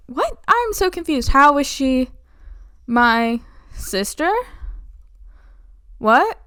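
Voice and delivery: a hammed-up stunned son; bewildered. Edmund reeling at the news, high bewildered.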